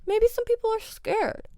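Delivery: very high, soft mock-scared voice; mock timid. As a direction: tease the scaredy drivers in a tiny high voice. Mimics timid drivers, then repeats normally.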